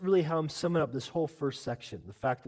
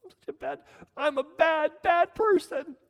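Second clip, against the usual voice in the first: whiny self-loathing voice, much higher, rising louder; mock self-pity; 13 semitones higher, 8 dB louder than usual. Preacher mocking false humility, high whiny self-pity. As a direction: ham up a person wallowing in their own badness. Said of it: "It's not".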